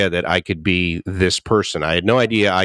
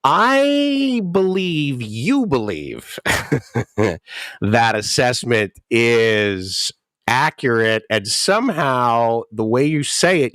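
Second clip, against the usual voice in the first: mock polite reply; slower than usual. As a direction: answer with exaggerated patient politeness. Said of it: "And I'm going".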